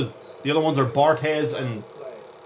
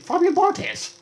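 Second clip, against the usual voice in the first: yelled name; slower than usual. Real, not acted. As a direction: belt the name out like a fan. Yelling player names during game.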